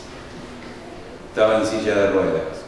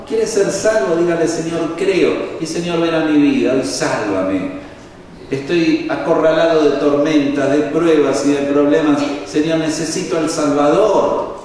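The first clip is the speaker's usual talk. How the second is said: loud, high preaching, building; fervent altar call; 5 semitones higher, 3 dB louder than usual. Acted. Preacher urging listeners to pray for salvation, fervent and loud.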